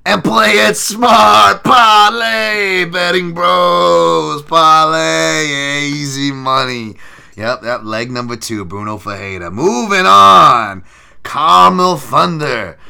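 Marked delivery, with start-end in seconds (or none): 0.1-6.9 loud, high, drawn-out sales pitch; betting bro hype. Pretend. Hyping the parlay to betting bros, loud drawn-out pitch. 9.5-12.8 loud, high, slow ring-announcer call; hammed-up announcer. Announcing the next fight loudly, slow and hammed-up.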